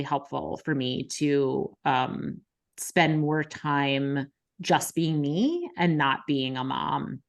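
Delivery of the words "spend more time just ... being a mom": usual speaking voice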